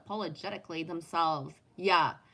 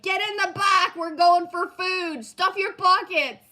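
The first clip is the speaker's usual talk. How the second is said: pretend loud calling; 9 semitones higher, 9 dB louder than usual. Rallying friends for the early bird buffet, she calls out loud and high. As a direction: bark it out like rounding up the crew for a buffet.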